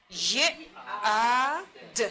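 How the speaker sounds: ید sounded out as ی, long ا, د; sounding out. Shown as a demonstration. Teacher prompting pupils on the letters, sounds loudly separated. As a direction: say each sound of ید loudly and apart.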